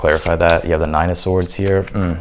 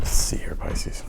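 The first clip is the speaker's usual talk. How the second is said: whispering; 6 dB quieter, slower than usual. Real, not acted. Reader muttering while pulling cards.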